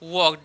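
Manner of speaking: वॉक with no L sound; वॉक as "wawk", vowel running into K; correct pronunciation. Shown as a demonstration. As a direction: keep the L silent in वॉक. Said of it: "इस प्रकार से होगा वॉक".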